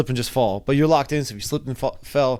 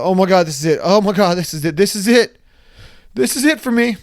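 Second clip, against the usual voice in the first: panicked thought; 5 semitones higher, 5 dB louder than usual. Retold or imitated. Thoughts while falling.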